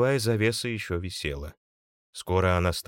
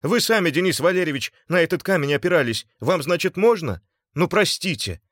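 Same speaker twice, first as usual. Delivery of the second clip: high, loud, defensive plea; agitated, high-pitched protest turning to begging; pleading; 10 semitones higher, 6 dB louder than usual. A narrator's reading. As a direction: plead your case under attack. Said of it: "взмолился Артём".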